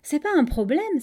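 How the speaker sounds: «C'est pas un problème» breezily dismissed, high and loud; offhand reassurance on «C'est pas un problème»; casual statement. A narrator's reading. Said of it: "d'un ton très dégagé".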